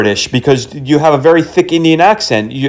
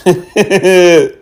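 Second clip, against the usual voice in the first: drawn-out giggle, higher than speech; amused laugh; 4 semitones higher than usual. Real, not acted. Scam baiter amused by caller trying to understand, giggling.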